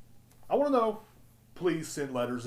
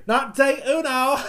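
laughing through a mock 'day uno', pitched up and loud; laughing mockery; 13 semitones higher, 11 dB louder, slower than usual. Podcaster laughing at the Taco Bell slogan, mock delivery. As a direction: scoff at the slogan while cracking up.

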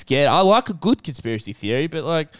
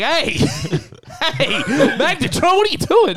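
high-pitched, half-laughing mock panic; mock alarm; 10 semitones higher than usual. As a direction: protest in comic alarm, pitch leaping up. Man trapped in cooking microwave, retold.

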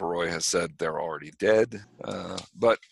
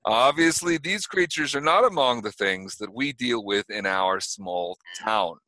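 put-on sheriff in a light New York accent; a police chief talking Brooklyn; mild New York cop accent; 6 semitones higher, 4 dB louder than usual. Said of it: "I don't know why I'm suddenly speaking in a New York accent".

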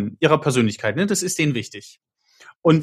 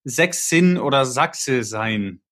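slow mock declaration; much slower than usual. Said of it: "wenn er sagt".

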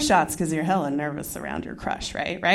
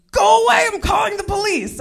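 higher and louder, a yelled threat through a door; angry yell; 9 semitones higher, 6 dB louder, faster than usual. Retold or imitated. Neighbour yelling at the naked stranger knocking, loud and angry.

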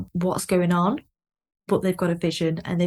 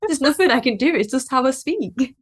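higher, louder, laughing through it; laughing reply; 5 semitones higher, 3 dB louder than usual. Retold or imitated. Quoting the author's laughing, higher, louder reply. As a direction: author brushing off critics, laughing as he speaks. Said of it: "And he replied to them, and he was like".

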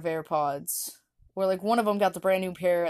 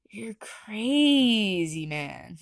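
mock whisper; much slower than usual. Friends mock the odd unicorn.

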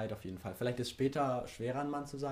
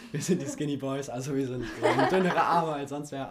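laughing; 5 semitones higher, 11 dB louder than usual. Real, not acted. Laughs about skinny boys.

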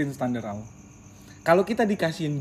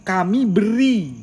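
Kami beri said slow and loud, with a falling end; stress on Kami beri; 7 semitones higher, 10 dB louder, much slower than usual. Shown as a demonstration. Uncle shows Acil that God gives, stress on Kami beri. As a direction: lean hard on Kami beri, drawn out. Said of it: "kalau intonasinya Om kayak begituin".

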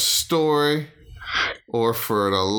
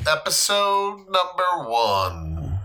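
announcer voice; 6 semitones higher, slower than usual. Acted. Podcast intro announcement.

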